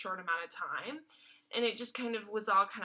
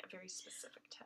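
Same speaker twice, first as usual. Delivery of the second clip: wry whisper; 18 dB quieter than usual. Real, not acted. Dry aside.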